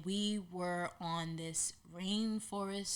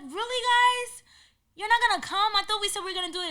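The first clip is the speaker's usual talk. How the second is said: mock disbelief; 13 semitones higher, 10 dB louder, faster than usual. Teasing friends who refuse to climb, in high loud disbelief. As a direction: playfully scold friends for backing out.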